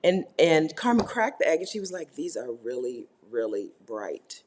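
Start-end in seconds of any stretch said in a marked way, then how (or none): 2.2-4.4 disapproving. Retold or imitated. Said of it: "she said it as if there was something wrong".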